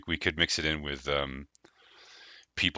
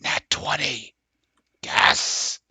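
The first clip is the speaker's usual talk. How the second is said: whispered cheer, forceful but breathy; whisper shout; 7 semitones higher, 3 dB louder, much slower than usual. Shown as a demonstration. Pitching dice-roll ASMR to the group, whisper-shouted. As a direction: celebrate a dice roll in a loud stage whisper. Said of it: "whisper shout things".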